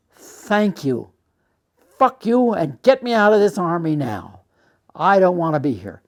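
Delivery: re-enacted outburst at an officer; angry retort. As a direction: fire back at a superior, building in force. Angry retort, then storms out.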